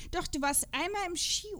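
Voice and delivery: ordinary delivery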